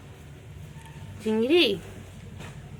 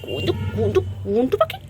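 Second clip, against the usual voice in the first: much higher, louder playful squeak; 12 semitones higher, 3 dB louder than usual. Real, not acted. Squeaky baby voice playing with pet.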